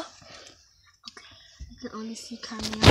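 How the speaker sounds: hushed whisper in a closet; whispering while hiding. Real, not acted. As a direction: whisper so nobody hears.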